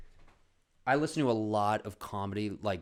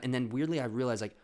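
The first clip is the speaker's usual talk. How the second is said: normal talking voice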